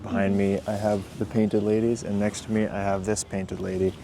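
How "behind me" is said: normal talking voice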